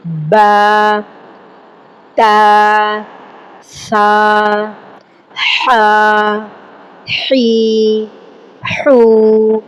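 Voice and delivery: each syllable با، تا، سا said alone, held and evenly; letter sound demo. Shown as a demonstration. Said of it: "the sounds like".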